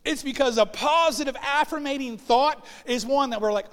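ordinary talk, nothing marked

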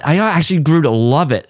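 ordinary talk

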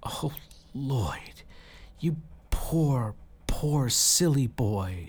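on the edge of tears; tearful tenderness. Acted. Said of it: "started crying".

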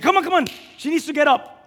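high, loud urging; loud retold demand; insistent shout. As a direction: push them to get her up. Husband demanding wife get up.

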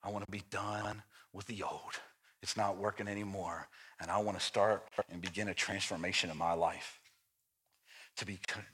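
low, soft preaching voice; hushed, intimate sermon delivery; quiet sermon. Acted.

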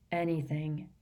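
soft, downcast mumble of a reply; glum. A narrator's reading. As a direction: answer as if nothing matters. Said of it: "said Ron glumly".

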